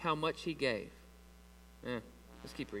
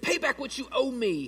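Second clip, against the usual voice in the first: higher-pitched, insistent reading; demanding; 6 semitones higher than usual. A narrator's reading. Servant to fellow servant who owes him, demanding. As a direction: press it as a mild demand. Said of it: "he demanded".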